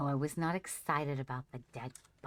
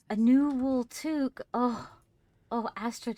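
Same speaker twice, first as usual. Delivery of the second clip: much higher and louder, thrilled; gleeful high exclaim; delighted squeal; 9 semitones higher, 10 dB louder than usual. Real, not acted. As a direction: squeal over a lucky find. Finding a new hat in game.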